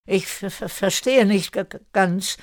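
high-pitched stammer on first sounds; stuttering. A narrator's reading. Erik admits to Erika he is confused, stuttering. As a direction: stumble repeatedly on the word beginnings. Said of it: "stotterte Erik".

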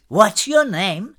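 high, slow drawn-out call; calling back. A narrator's reading. Girl calls back to Kire on deck, high stretched call. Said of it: "rief sie zurück".

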